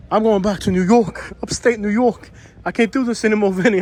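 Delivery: mock New York accent, exasperated and done. Vlogger imitating an upset lady he overheard, in a New York accent. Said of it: "talking in her New York accent".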